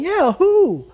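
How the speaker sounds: high mock-innocent squeak; Haman playing innocent. Haman feigning innocence before the king, high squeak. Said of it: "Haman's going".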